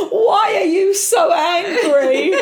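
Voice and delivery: very high, slow, laughing mock question; mum confronting teens. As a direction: ham up mum's earnest question through laughter. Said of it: "and said to him".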